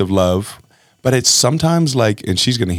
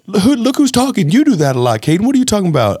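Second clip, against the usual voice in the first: high, mock-indignant retort to a kid; mocking defensive dad; defensive comeback; 10 semitones higher than usual. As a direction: throw it back at him, offended. Defensive comeback quoted to son.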